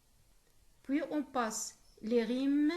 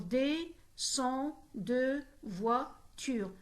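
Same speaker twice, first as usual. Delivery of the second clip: "des, sont, de, voiture" slowly, each syllable apart; much slower than usual. Shown as a demonstration. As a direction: count out "des, sont, de, voiture" with a gap after every syllable. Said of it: "c'est une syllabe".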